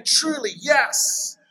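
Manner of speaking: high, emphatic affirmation; passionate agreement. Acted. Preacher shows what amen means, passionate agreement. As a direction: agree with real conviction. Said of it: "I agree with it passionately".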